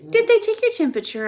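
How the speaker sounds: put-on boy fretting over new friend; anxious rush. A narrator's reading. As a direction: rush the question in worry. Stuart worried for his friend.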